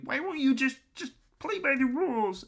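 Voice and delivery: high, loud whiny wail; mock whining. Mocking angry, coping critics.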